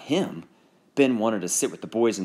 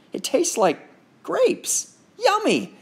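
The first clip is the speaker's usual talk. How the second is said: high, slow, savoring; sweet little-girl glee; delighted; slower than usual. Acted.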